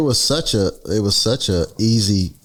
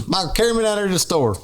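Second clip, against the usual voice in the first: high, easy Southern twang; strong Southern accent; 10 semitones higher than usual. Shown as a demonstration. Showing a co-host the saying, in strong Southern accent. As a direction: lay the Southern accent on thick. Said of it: "putting that accent on a little strong".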